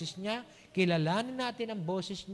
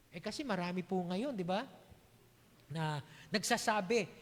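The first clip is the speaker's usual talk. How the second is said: ordinary delivery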